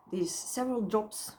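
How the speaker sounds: ordinary talk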